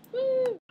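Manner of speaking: high, loud "woo"; short cheering "woo"; excited whoop. Real, not acted. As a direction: let out a quick high whoop. Spontaneous cheer between topics.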